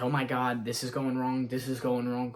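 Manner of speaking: usual speaking voice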